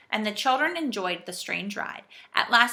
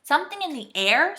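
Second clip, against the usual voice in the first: higher, louder questioning voice; a touch of worried query; anxious; 7 semitones higher, 5 dB louder than usual. A narrator's reading. Saucepan man mishears and looks up, anxiously. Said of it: "he looked up anxiously".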